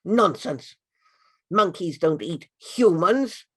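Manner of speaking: put-on a king scoffing at a frightened girl; gruff dismissal. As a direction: wave her worry away. King brushes off her fear.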